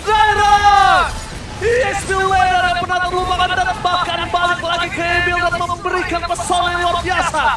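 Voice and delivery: loud, high esports hype; hype caster shout. Acted. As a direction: shout it like a big play call.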